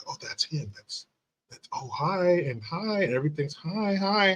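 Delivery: high, breathy schoolgirl squeals; excited little girls. Retold or imitated. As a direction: gush like starstruck third graders. Third grade girls gushing over son.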